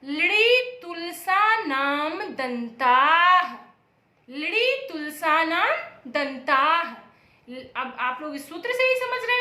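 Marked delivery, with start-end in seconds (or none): 0.0-3.5 drawn-out sutra chant, a bit louder; teacher chanting the sutra slowly; very slow recitation. Shown as a demonstration. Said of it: "कौन सा सूत्र है". 4.3-6.9 teacher repeating the sutra slowly; slow recitation. Shown as a demonstration. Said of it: "कौन सा सूत्र है".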